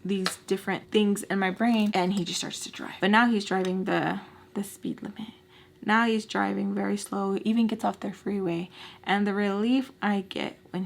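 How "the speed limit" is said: whisper. Retold or imitated. Driving under the speed limit.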